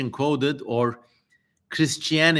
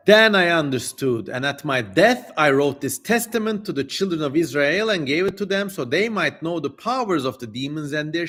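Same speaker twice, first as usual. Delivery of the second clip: raised, declaiming scripture voice; solemn reading; 4 semitones higher than usual. Acted. Streamer reading the demon's prophecy to chat, solemn declaiming. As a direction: read it like an old holy text. Reads Solomon's deathbed testament.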